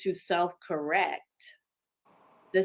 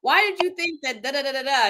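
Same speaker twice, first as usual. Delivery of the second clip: higher, incredulous scolding, loud at the start; sister scolding; 6 semitones higher, 4 dB louder than usual. Retold or imitated. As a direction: sister telling her off in disbelief. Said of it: "she said".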